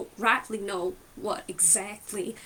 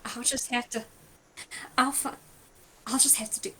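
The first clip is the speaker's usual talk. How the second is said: ordinary talk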